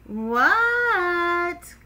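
high, loud, long-held reply; calling back. Acted. Froggy answers his mother's call, hollering back. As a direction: holler back with the word stretched out.

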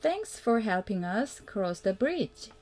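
ordinary delivery

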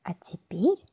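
plain reading voice

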